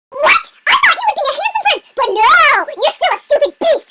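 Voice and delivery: high, loud hammed-up outrage; furious princess tirade; really angry. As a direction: shriek in indignant disappointment. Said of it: "she was really angry".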